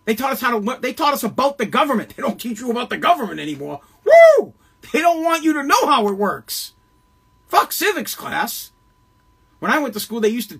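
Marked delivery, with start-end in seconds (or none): 4.1-4.5 loud high whoop; excited whoop. Real, not acted. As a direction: let out a whooping cheer. Excited outburst mid-rant.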